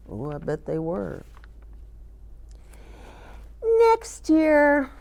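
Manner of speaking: normal talking voice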